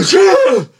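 exaggerated high-pitched mock sneeze; loud fake sneeze. Imitating a schoolboy sneezing for no reason, high mock sneeze. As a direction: fake a big squealed sneeze. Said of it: "he's just like".

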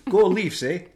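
loud, high mock Canadian cheer; Canadian accent. Topping the accent joke with co-hosts, loud mock Canadian accent. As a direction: ham up a Canadian hockey-fan shout. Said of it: "I'd do a Canadian accent".

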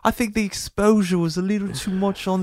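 high, loud British impression; put-on British accent, high-pitched; mock British accent. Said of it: "why are you giving him a f-, British accent".

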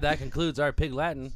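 ordinary talk, nothing marked

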